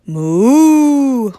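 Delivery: high, loud long moo; long happy moo; contented moo. Acted. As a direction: moo happily. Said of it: "went Cow".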